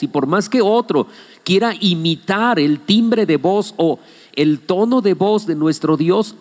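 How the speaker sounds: ordinary talk, nothing marked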